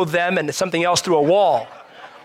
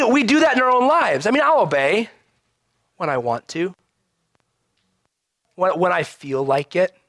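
ordinary talk, nothing marked

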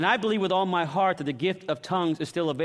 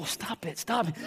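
hushed retort; 6 dB quieter than usual. Retold or imitated. Preacher answers his cautioning wife.

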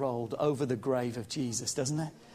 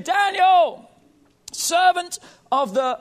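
high, louder anguished calling; 13 semitones higher, 7 dB louder, slower than usual. Acted. The king calls into the lions' den, anguished. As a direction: call out in dread for your servant. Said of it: "a voice of anguish".

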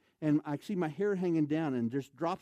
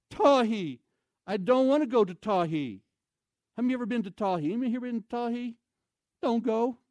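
comic dread; 6 semitones higher, 6 dB louder than usual. Real, not acted. Dreading the mountain trip.

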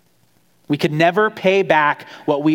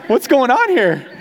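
higher and slow, bracing for a collision; startled mock panic; mock alarm; 8 semitones higher than usual. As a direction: play the son flinching as dad charges in, pitch raised. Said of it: "I'm like".

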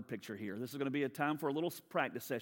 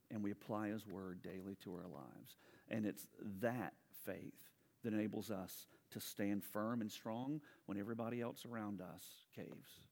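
ordinary talk

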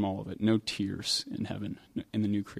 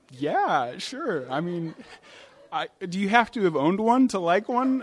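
laughing, much higher, rising to louder; laughing reply; 7 semitones higher, 3 dB louder than usual. Retold or imitated. Preacher retelling his amused answer about coats, laughing. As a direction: chuckle through it, amused and incredulous. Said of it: "And I'm like".

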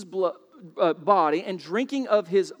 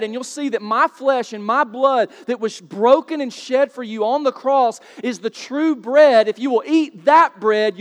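louder, higher urgent appeal; full-force preaching; 4 semitones higher, 6 dB louder than usual. Acted. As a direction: plead with the crowd at full voice. Preacher pleading with the congregation.

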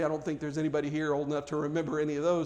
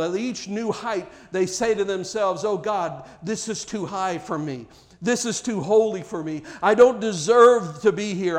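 louder, higher fervent pulpit preaching; full-force preaching; 4 semitones higher, 6 dB louder than usual. Acted. Pastor preaching to his church at full force. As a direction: preach it out, climbing with fervour.

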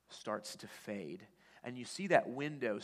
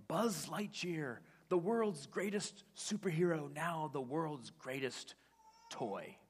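big, slow, higher announcer voice; TV commercial announcer; 5 semitones higher, slower than usual. Retold or imitated. The commercial announcing Buzz the toy, ad voice. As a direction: sell the toy like an ad. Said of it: "you hear this voice say".

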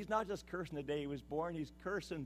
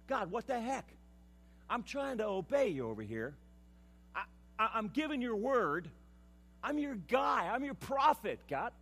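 higher and louder, shouting at God; frustrated outburst; 7 semitones higher, 6 dB louder than usual. Acted. Preacher voicing Jeremiah yelling at God, angry shout. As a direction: build to a shout of protest.

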